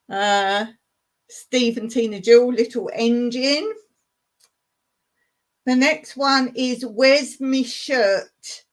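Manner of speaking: normal talking voice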